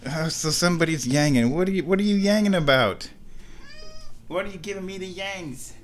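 high, cooing pet voice. Real, not acted. Talking to the meowing cat.

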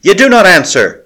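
loud and high, hurling the words; crying out. Acted. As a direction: call out a challenge high and loud. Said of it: "she cried again".

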